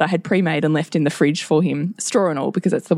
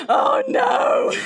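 very high mock horror; dismayed cry. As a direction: exclaim it in a high, stricken pitch. Mother's horrified reaction acted out.

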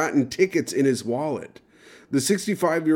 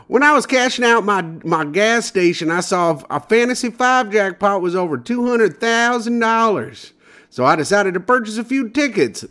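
Michigan lottery winner impression; 7 semitones higher than usual. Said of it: "I'll do my impression of what I think he sounds like".

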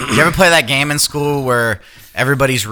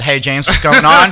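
loud greeting; 5 semitones higher, 7 dB louder than usual. Acted. Acted greeting in intro.

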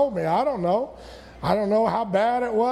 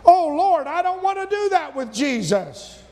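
fervent exclamation; 7 semitones higher than usual. Real, not acted. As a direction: burst out with conviction.

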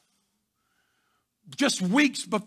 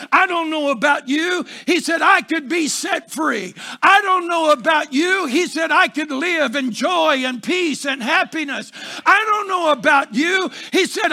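loud, higher climactic preaching; preaching at full force; 3 semitones higher, 5 dB louder than usual. Real, not acted. Pastor rallying the congregation, climactic full-force preaching. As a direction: build the refrain to a roar.

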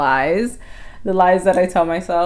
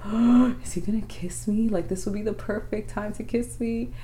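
soft gasp, then higher hushed wondering; excited anticipation; 4 semitones higher, 5 dB quieter than usual. Retold or imitated. Girlfriend recalling waiting for a first kiss, excited anticipation. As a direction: retell a giddy inner thought, kept soft. Retelling her excited inner thoughts.

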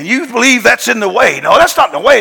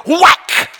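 high, sharp "whack!" sound effect; mock impact noise; 11 semitones higher than usual. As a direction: smack the word out like a blow. Sudden revival in storytelling.

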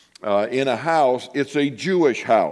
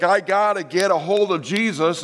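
desperate; 5 semitones higher than usual. Retold or imitated. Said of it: "She's like".

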